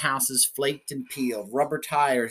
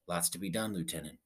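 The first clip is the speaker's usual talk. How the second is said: softer, low droning voice; quiet drone; 6 semitones lower, 9 dB quieter than usual. A narrator's reading. Captain droning to his lieutenant, softer and low. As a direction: let it fade into a quiet drone. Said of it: "His voice droned on and quietly on".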